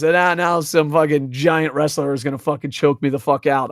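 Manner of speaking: ordinary delivery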